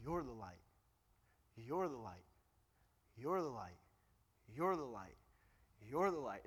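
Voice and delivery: low, soft repeats leaning on "You're"; stress on You're. Shown as a demonstration. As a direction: press "You're" each time, hushed and low. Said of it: "the emphasis is on you".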